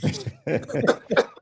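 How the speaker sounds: breathy high laugh; high wheezing laugh; high unvoiced laugh. Real, not acted. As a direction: burst out laughing at the joke. Laughing at the impression.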